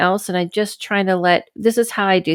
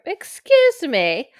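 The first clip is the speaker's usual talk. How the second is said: mock formal excuse me; 8 semitones higher, much slower than usual. Real, not acted. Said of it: "Excuse me for my burp".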